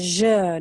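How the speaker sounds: "je" with a long French schwa; "je" said zhuh, vowel held. Shown as a demonstration. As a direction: draw out "je" as zhuh, French style. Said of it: "si alguien habla francés".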